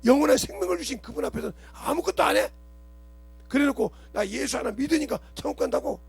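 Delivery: 높은 음으로 꾸짖으며; 교인들을 나무라는 설교 목소리; rebuking preaching. Acted. (나무라는 설교)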